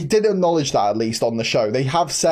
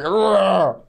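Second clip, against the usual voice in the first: mock Hulk growl; 3 semitones higher than usual. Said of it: "He's going".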